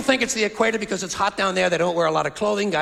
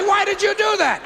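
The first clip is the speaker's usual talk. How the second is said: demanding question; 11 semitones higher than usual. Retold or imitated. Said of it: "I wanna say".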